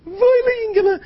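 very high-pitched gushing voice; cooing admirer. Acted. Parents admiring their good child, high squeaky coo. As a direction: coo it like praise for a darling little boy.

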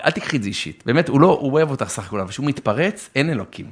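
normal talking voice

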